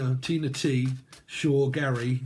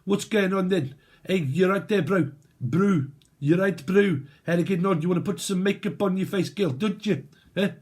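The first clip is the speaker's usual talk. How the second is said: higher-pitched mock South African; South African accent; 4 semitones higher than usual. Imitating how they sound over there, South African accent. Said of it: "is that kind of like how they sound over there?".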